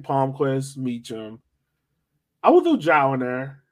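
ordinary talk

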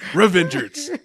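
high, loud comic war cry; mock battle cry. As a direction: shout it like a rallying call.

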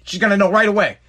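normal talking voice

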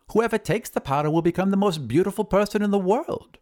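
high-pitched wizard's promise. Acted. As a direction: proclaim the powder's magic in a high voice. Wizard touts his magic powder.

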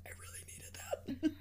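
a whispered mock lament for the spilled drink. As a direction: whisper the loss like a tragedy. Friend mourning the spilled drink.

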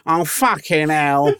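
slow, high nerdy voice; nerdy Owl voice. Retold or imitated. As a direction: drawl it out like a nerd. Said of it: "putting on the nerdy Owl voice".

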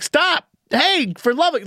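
high-pitched retold yell; loud. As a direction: call out sharply to stop someone, pitch jumping up. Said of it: "I was loud 'cause I was like".

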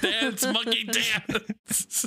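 slow, teasing chant; slow mocking taunt. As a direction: goad him like a ringmaster. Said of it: "don't make me do this".

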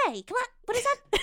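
high, quick Tarantino voice; quick, high-pitched Tarantino take; Tarantino impression. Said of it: "That's closer".

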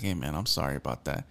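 softly imitated low apology; retold quiet apology. Friend apologizing to the teller for the lady, quiet and low.

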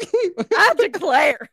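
loud, drawn-out Southern exclamation; mock Southern outrage. Cohost answering being blessed, loud mock Southern outrage. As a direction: declare it slowly as an affronted Southerner.

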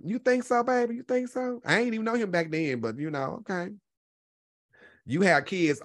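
ordinary delivery

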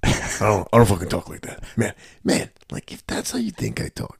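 lightly voiced Tom, grumbling; a hint of Tom's gruff complaint; mock offended Tom, protesting he doesn't talk like that; Tom impression. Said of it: "because he's like".

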